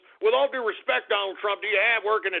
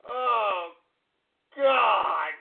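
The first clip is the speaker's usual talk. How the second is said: ordinary talk, nothing marked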